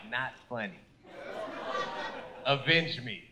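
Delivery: mock cold wife. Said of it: "She looks at him like".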